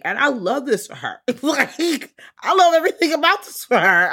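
high, loud squealing joy with a laugh; shrieking with happy laughter; real delight. Real, not acted. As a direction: gush like a proud best friend. Gushing over Sookie's growth.

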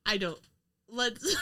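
ordinary delivery

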